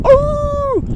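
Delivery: a sudden squeaky yelp up high; high startled sheep cry. Acted. Early sheep hearing the wolf, squeaky high yelp.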